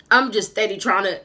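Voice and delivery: can barely talk for laughing. Real, not acted. To viewers, laughing about fixing the wig.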